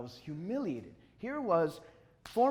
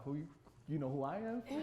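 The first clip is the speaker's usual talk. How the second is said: retold Chicago street comeback, not raised; Chicago street talk; 6 dB quieter than usual. Artist answering the big man, mild Chicago attitude. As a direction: throw it back with a touch of Chicago. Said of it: "I switch in that Chicago".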